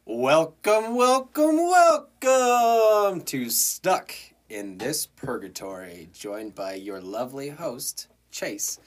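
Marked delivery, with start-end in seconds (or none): 0.1-6.1 loud, high, drawn-out show intro; showman's welcome. Pretend. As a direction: belt out the welcome like a ringmaster. Host shouts the show's opening.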